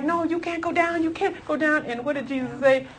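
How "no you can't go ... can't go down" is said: high-pitched objection at normal volume; mock Peter-style remonstration, pitched high; insistent protest. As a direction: plead with a friend not to go.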